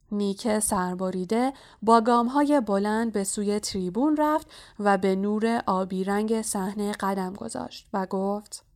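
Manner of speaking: plain reading voice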